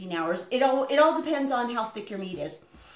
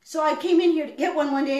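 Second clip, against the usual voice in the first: ordinary talk, nothing marked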